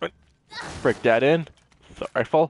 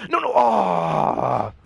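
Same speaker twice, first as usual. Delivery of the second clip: drawn-out cry of dismay; alarmed cry; 3 semitones higher, much slower than usual. Real, not acted. Player caught out mid-game, drawn-out alarmed cry. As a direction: let out a long, startled "no".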